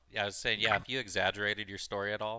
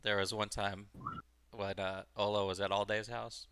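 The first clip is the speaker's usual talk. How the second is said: usual speaking voice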